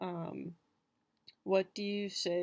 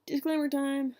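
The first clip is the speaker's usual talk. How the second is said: high, loud mock announcer call; playful announcement; 8 semitones higher, 5 dB louder than usual. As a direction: announce it playfully, pitched up and louder. Playfully announcing her disclaimer.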